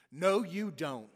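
loud and drawn out, No, you don't stretched slowly; slow yell. Retold or imitated. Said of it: "And Harry yelled".

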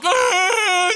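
put-on sudden high wailing cry of a toddler; toddler's wail. Two-year-old whose lollipop just shattered, wailing cry. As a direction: let out a loud child's crying shriek. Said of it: "Two-year-old, like".

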